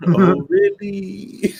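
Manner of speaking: slow, high, laughing mimic; mocking echo. Friend teases her, echoing her squeal slowly. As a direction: drag it out while laughing.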